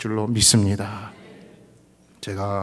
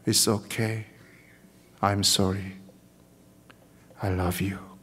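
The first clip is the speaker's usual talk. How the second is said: gentle voice of God; 3 semitones lower, much slower than usual. Pretend. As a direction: assure them gently as a loving father. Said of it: "말씀하시는 줄로 믿습니다". (하나님이 안아주며 다정하게 하는 말)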